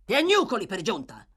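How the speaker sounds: scornful outburst; angry exclamation. A narrator's reading. Brute to the whimpering girl he struck, angry exclamation. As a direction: exclaim it in mild angry disbelief. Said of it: "esclamò".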